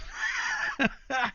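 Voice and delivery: shrieking laugh; high squealing laugh. Real, not acted. Killed Stephen in game.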